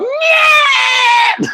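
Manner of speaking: high screaming yell; shrieked yeah; imitated scream. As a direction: scream it like the hysterical wife. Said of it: "She screams".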